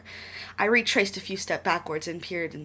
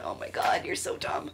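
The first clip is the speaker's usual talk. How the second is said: whispered exasperated aside; whispering. Real, not acted. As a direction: whisper it in disbelief.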